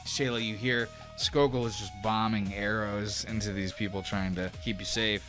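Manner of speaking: ordinary delivery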